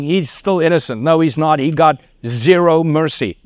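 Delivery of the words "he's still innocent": usual speaking voice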